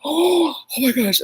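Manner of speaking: high imitated gasp of delight; excited. Wait staff freaking out over the visitor, excited. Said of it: "They get all excited. They're like".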